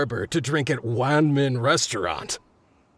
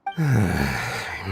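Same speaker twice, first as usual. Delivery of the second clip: a long tired sigh before answering; weary sigh; 7 semitones lower than usual. Acted. Site worker sighing at questions from strangers, weary sigh. Worker asked about newcomers.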